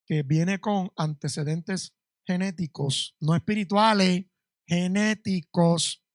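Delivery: "genéticos" drawn out very slowly; stress on genéticos. Shown as a demonstration. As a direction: stretch out "genéticos" to drive it home. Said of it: "stressing genetic, not spiritual".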